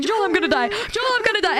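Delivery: high, whiny comic despair; mock panic. Pleading to a friend before the show, mock panic. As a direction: wail it dramatically, climbing on the repeat.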